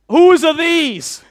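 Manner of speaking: loud, high, drawn-out demand; teacher calling out. Acted. Teacher holding up lost underpants before class, loud calling out. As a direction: call it across the room like a teacher. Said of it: "when the teacher holds up a pair of Y fronts".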